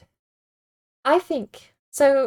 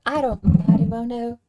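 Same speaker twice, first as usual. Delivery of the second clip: louder second take of the western twang, leaning into it; more committed western twang, loud and sure; 7 dB louder than usual. Shown as a demonstration. Streamer commits to his Blackwater character, in a western twang. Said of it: "I'm getting into it".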